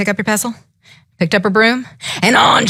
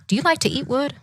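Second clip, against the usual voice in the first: usual speaking voice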